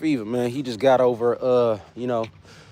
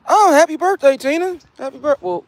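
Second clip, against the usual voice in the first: high, loud, bright birthday greeting; excited birthday wish; 14 semitones higher, 6 dB louder than usual. Real, not acted. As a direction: cheer out happy birthday to Tina. Birthday wishes to viewer Tina.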